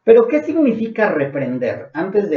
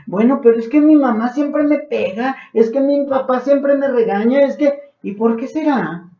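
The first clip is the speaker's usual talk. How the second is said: put-on whiny stubborn child; 7 semitones higher than usual. Stubborn child blaming parents.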